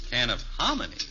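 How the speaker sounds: usual speaking voice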